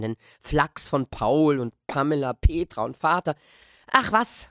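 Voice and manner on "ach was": higher, brushing it off; boy calling out. Acted. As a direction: wave the worry away. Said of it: "rief ich".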